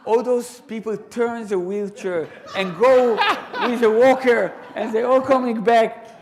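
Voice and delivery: loud, high, laughing delivery; amused retelling; laughing while telling. Real, not acted. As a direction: tell it through laughter. Crowd returning, laughing telling.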